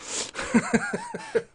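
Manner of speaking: rapid high, soft giggling; high laugh. Acted. Streamer cracking after his rant, high laugh. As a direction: let out a pent-up giggle.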